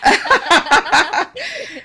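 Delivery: high loud laugh; real amused laugh. Real, not acted. Guest still laughing at her own joke.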